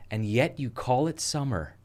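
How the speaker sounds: slow, drawn-out puzzled American lady; slow put-on American voice; imitated American lady. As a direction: mimic a shopper's earnest puzzlement. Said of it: "then she said".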